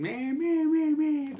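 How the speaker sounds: high, whiny mock of his ex going man man. Venting about his ex, mocks her in a high whiny voice.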